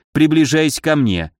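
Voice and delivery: ordinary delivery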